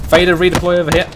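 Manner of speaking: loud, fast command; barked order. Real, not acted. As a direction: snap the order out quickly. Commanding squad in firefight.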